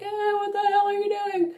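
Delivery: high squealed voice for protesting eyes; panicked eyes. Acted. Eyes protesting the lash makeup, high mock panic. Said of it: "my eyes are like".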